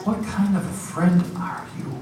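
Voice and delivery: high-pitched, wounded reproach, acted; disappointed. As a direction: act her let-down reproach of a friend. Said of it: "looked at me with disappointment".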